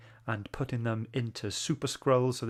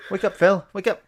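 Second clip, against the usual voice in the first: louder, higher jokey nudge; teasing wake-up call; 7 semitones higher, 8 dB louder than usual. Real, not acted. Host teasing his sleepy co-host, louder and higher. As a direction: poke him awake with a playful call.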